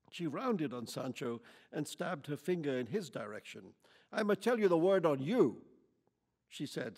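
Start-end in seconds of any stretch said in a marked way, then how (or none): 4.1-5.6 loud, high, building hostile rant; angry tirade. A narrator's reading. Lady interrogates Quichotte about where he's from, furious. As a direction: pile accusations on him.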